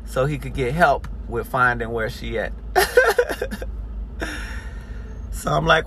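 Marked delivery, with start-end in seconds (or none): 2.6-5.3 high, voiceless wheeze of a laugh; breathy high laugh. Real, not acted. Telling the story, wheezing with laughter. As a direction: let the laugh escape as air.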